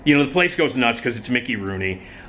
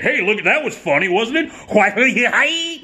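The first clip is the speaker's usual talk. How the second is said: fourth-wall mugging; 8 semitones higher, slower than usual. Retold or imitated. Actor breaking the fourth wall to fish for laughs. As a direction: nudge the audience to laugh. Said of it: "breaking the fourth wall and saying".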